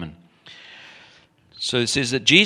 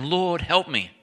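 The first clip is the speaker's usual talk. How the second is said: retold plea; 8 semitones higher, slower than usual. Woman kneeling before Jesus for help, acted high plea. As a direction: plead upward as if kneeling. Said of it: "came and knelt before him".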